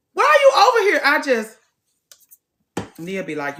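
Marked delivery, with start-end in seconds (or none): 0.1-1.0 high, loud exasperated question. Retold or imitated. To the woman crowding her at the gym, exasperated. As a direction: throw the question up high in disbelief.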